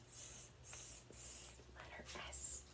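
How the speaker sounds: a soft snake hiss. Shown as a demonstration. Teacher showing kids the snake sound, a hiss. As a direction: breathe out "S" like a snake. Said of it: "Sammy Snake sound".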